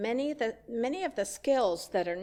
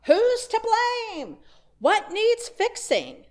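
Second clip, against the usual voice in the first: high, loud, slow alarmed questions; anxious questions; 11 semitones higher, 7 dB louder than usual. Acted. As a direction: voice the panic of someone hit by misfortune. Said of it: "our first questions are".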